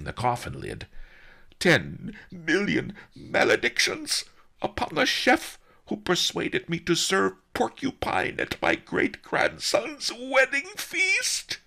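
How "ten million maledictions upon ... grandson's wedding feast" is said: high, loud cursing shriek. A narrator's reading. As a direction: shriek the curse in theatrical anguish. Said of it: "shrieked Master Li".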